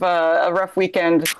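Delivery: usual speaking voice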